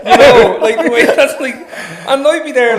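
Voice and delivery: high, loud laughing; laughing at the college bar crowd. Real, not acted. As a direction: laugh through the mockery.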